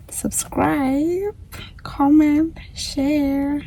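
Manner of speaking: high squeaky plea. Acted. Playful high sign-off request.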